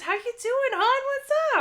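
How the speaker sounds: squeaky high-pitched greeting; jokey falsetto hello; high silly voice. Pretend.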